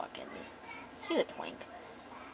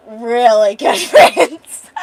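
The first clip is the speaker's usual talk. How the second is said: loud, drawn-out hammed-up insistence; emphatic cover-up; 13 dB louder, much slower than usual. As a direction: stretch it out loudly, breaking into laughter. Man awkwardly covers for the kiss.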